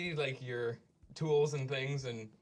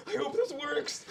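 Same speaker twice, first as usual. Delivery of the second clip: high-pitched, louder blurted worry; amplified thoughts; 13 semitones higher, 5 dB louder, slower than usual. Acted. Nervous creature's thoughts broadcast by a spell, high and loud. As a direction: think aloud at high pitch, amplified for all. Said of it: "A loud voice narrates your thoughts".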